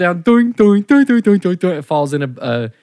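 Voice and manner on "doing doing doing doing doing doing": high, loud bouncing doing doing; mock pinball pings; Plinko bounce noise. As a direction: bounce the head down the pegs. Plinko head bouncing down pegs.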